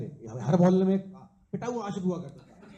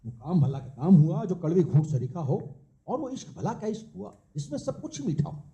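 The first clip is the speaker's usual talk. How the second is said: measured, rhythmic verse with a lilting refrain; live poetry recital; poem recitation; 4 semitones lower than usual. Acted. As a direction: recite it as a couplet, landing on each rhyme.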